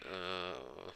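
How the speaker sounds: soft, drawn-out sleepy mumble; sleep talking. Acted. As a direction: murmur it quietly as if fast asleep. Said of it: "also was kinda saying asleep".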